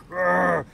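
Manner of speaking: mock growl. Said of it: "I was like".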